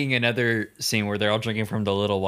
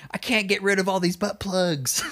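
mock scream; 8 semitones higher than usual. Unseen man crying out in the hearing, screaming. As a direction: cry out in hammed-up despair. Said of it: "a man screaming".

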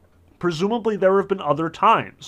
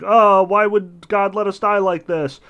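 mock whine, higher and louder; whining; 5 semitones higher, 6 dB louder than usual. Thirsty Israelites grumble to Moses, mock whining. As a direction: play up the crowd's complaint. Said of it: "they're whining about it".

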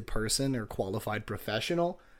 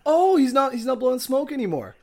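surprised; 12 semitones higher, 8 dB louder than usual. Real, not acted. Notices the changed drawing.

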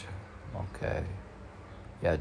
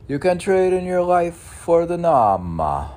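much higher, louder proclamation; proclaiming; 12 semitones higher, 10 dB louder than usual. Acted. Announcing his saying to followers, high and loud. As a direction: ring it out like a sermon line.

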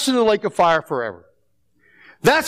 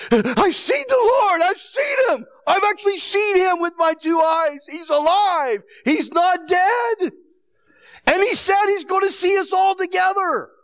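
much higher, breathless joyful rush; excited; 8 semitones higher than usual. Retold or imitated. Mary telling the disciples she saw the Lord, higher and overjoyed. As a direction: burst out like you can't believe he's alive. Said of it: "she was excited".

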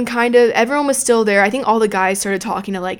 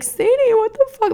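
much higher, near-tearful scold; imitating a tearful drunk mother; upset mom; 14 semitones higher than usual. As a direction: wail it like a mom about to cry. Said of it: "she was about to cry".

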